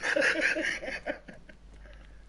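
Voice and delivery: breathy, trailing high laugh; laughter. Real, not acted. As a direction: laugh helplessly at your own story. Laughs at own roulette story.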